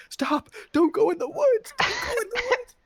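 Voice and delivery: high, frantic mock screams; panicked yelling. Friends begging him not to walk into the woods, high panicked yells. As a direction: yell after him, pleading in fake panic.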